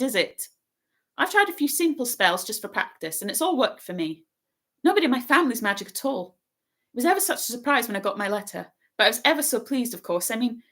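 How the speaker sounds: high, rapid bossy chatter; very fast. A narrator's reading. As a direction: rattle it all off in one breath. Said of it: "She said this all very fast".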